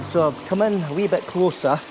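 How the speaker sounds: ordinary talk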